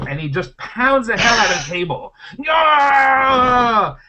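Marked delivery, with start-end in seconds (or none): 2.4-4.0 high, loud, drawn-out attack cry; wild yell. Acted. Player pounding the squirrel bag on the table, wild yell. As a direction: yell it like a frenzied battle cry.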